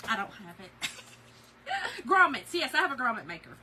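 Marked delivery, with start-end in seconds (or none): 0.1-2.1 laughing at herself. Real, not acted.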